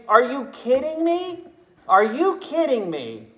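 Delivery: high, disbelieving eye-roll; incredulous mock retort. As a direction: scoff at him in disbelief. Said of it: "would roll your eyes and say".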